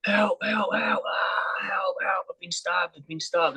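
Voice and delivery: mock scream, high and drawn out; retold screaming. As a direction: imitate a stabbed man calling out. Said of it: "he's screaming, holding his back".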